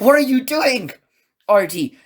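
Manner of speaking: high, loud mock exasperation; high-pitched retold protest; retold outcry. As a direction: imitate the room crying out in alarm. Others react to channel change.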